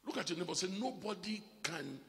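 rising, loud sermon voice; preaching. Acted. Preacher exhorting the congregation, loud and rising. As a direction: preach it out to the church.

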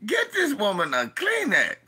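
fed-up plea for a tissue; exasperated high call. Retold or imitated. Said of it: "I'm like".

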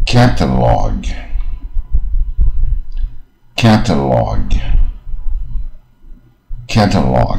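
"catalog" slowly, tongue well curled, long open "log"; careful, slow "catalog" with open "cat" and "log"; model curled-tongue pronunciation. Shown as a demonstration. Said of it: "第一个就是它是卷舌卷的比较多".

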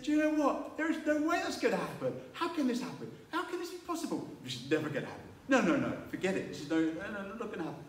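high, laughing scoff of disbelief; disbelieving Zechariah. Retold or imitated. Zechariah scoffs at the angel Gabriel, high laughing disbelief. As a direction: laugh it off as impossible. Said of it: "Zechariah turned around and said".

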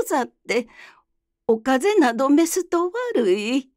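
lightly voiced older geisha, surprised; refined woman's voice. Narrator voices Okaji.

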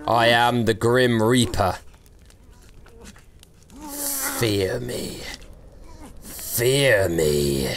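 low, slow, mock ominous reaper; hammed-up low menacing reaper; Grim Reaper voice. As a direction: play the reaper, dragging out the threat.

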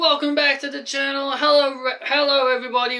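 high, hyped-up channel greeting; excited. Real, not acted. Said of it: "you guys can clearly tell I am excited".

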